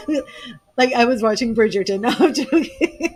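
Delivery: laughing through her words; a real giggle as she jokes; chuckling at her own Bridgerton joke. Real, not acted.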